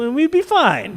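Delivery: ordinary talk, nothing marked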